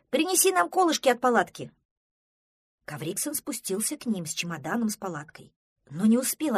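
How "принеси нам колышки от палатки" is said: high, loud call; shouting. A narrator's reading. Простодурсон asks Кавриксон for tent pegs, shouting. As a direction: holler a request across a slope. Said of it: "крикнул в ответ Простодурсон".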